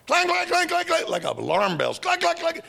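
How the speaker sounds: high, loud ringing clangs; clanging imitation. Teller mimicking pipes clanging like alarm bells.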